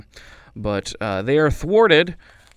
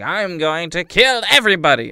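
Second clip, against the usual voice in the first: mock villain declaring it, higher and louder; hammed-up villain; 7 semitones higher, 7 dB louder than usual. Lady Hogbottom announcing her plan, a hammed-up villain voice. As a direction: ham up a cartoon villain's threat.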